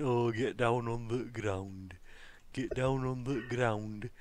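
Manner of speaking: slow, heavy North Yorkshire drawl on ground; North Yorkshire accent. Retold or imitated. Mimicking a Yorkshire grandma, slow North Yorkshire voice. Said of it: "that typical North Yorkshire".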